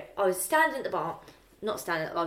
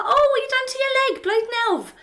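very high, mock-cheery banter; hammed-up nosy greeting, pitched right up; jokey exclamation; 5 dB louder, faster than usual. Bartender teasing a regular on crutches, loud-ish high banter.

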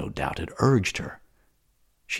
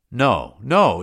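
a louder, higher cry of denial; exclaiming; 6 semitones higher, 8 dB louder than usual. Acted. Matho protesting to Salambo, louder exclaimed cry. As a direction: burst out in protest.

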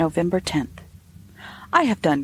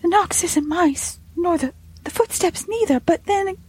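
high, halting maid's voice; flustered servant girl, pitched high; stammering; 11 semitones higher than usual. A narrator's reading. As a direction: stumble over the words and break off. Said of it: "she stammered".

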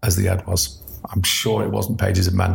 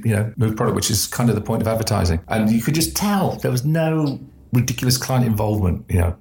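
normal talking voice

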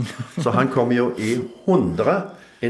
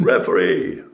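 hoarse, breathy bellow, much louder; big roar; 2 semitones lower, 4 dB louder, slower than usual. Retold or imitated. Goalkeeper roars to the Italian referee over the crowd, raw bellow. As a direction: let out a huge raw roar across the stadium. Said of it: "det store Skauen-brølet da".

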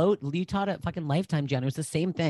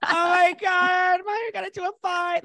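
exaggerated high concerned friend; hammed-up shock. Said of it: "'Cause I'm like".

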